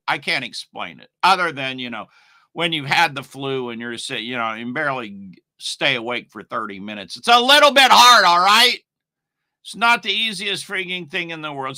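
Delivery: normal talking voice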